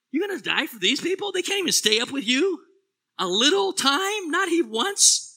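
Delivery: high, loud incredulous challenge; incredulous disbelief. Acted. Incredulous rhetorical challenge, much higher.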